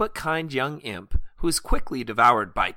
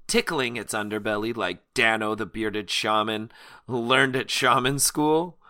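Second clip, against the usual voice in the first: ordinary talk, nothing marked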